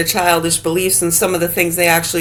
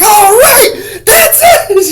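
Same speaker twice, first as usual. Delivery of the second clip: very high squeal, louder, laughing; squeaky officer snapping; 7 dB louder, much slower than usual. Retold or imitated. Imitating the officer on the bus losing her patience. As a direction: squeal it as she finally loses it. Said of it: "And she's like".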